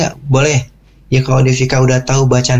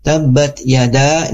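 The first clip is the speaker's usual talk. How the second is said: "yada" with its last vowel drawn out; long held YADA; much slower than usual. Shown as a demonstration. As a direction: recite slowly, holding the end of "yada". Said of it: "kita bertemu dengan bunyi yada". ("yada" dibaca panjang)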